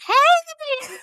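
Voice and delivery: very high bright hey; cheery friend greeting. Retold or imitated. Midwife friend answering video call, very high cheery greeting. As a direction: sing out hello like the bubbly friend. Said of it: "she's like".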